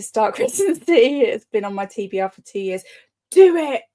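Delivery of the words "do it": eager urging. Real, not acted. Urging a viewer to start.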